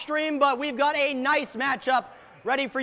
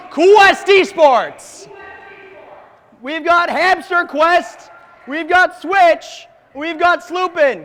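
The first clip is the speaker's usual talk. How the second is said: hype announcer; 5 semitones higher, 8 dB louder than usual. Real, not acted. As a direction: boom out each player name. Introduces second team on stage.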